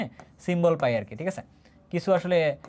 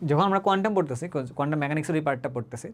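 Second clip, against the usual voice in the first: ordinary talk, nothing marked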